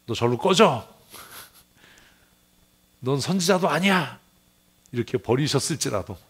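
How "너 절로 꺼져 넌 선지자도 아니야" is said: lightly acted stern dismissal, rising in pitch; mock divine rejection. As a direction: act out a rebuking Lord. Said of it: "주님께서". (내치듯 호통치는 주님 흉내)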